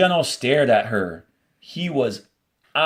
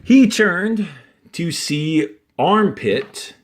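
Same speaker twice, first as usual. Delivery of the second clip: ordinary delivery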